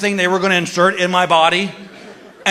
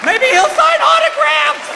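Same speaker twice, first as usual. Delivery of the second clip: high-pitched comic outburst; indignant mock rant; 10 semitones higher than usual. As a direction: build to a high, incredulous yell. Acted indignant rant at doctor.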